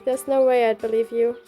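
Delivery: ordinary delivery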